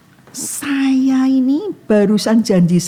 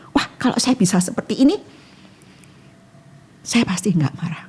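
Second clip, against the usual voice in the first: ordinary talk, nothing marked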